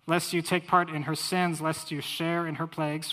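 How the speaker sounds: loud, ringing proclamation of scripture; scripture reading. Acted. Preacher reads Revelation nineteen aloud to his congregation, ringing out. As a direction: proclaim it like the roar of heaven's crowd.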